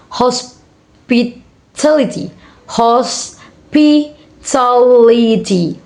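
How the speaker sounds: hospitality very slowly, broken into beats; syllable by syllable. Shown as a demonstration. Teacher showing five syllable word, syllable by syllable. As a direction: pause after each syllable of hospitality. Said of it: "five syllable words".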